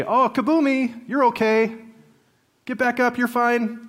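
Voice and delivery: high, breezy cheering-up parent voice; kaboomy parent. Acted. Parent to a child who fell down, light high reassurance. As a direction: brush off the tumble in a high, sing-out voice. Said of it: "he called kaboomy parents".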